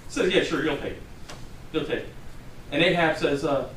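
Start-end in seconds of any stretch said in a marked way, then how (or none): 0.3-2.1 low, soft, offhand mock assent; mocking agreement. Prophet answering the king who wants good news, mocking. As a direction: humor him with sarcastic, lowered voice. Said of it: "mocking him".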